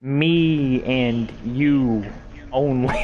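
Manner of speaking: drawn out very slowly, word by word; slow, deliberate. Retold or imitated. He insists to his crush it means just them two. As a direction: spell it out patiently. Slow clarifying quote to her.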